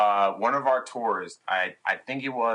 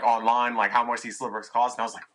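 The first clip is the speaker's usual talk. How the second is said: normal talking voice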